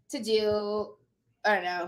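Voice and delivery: usual speaking voice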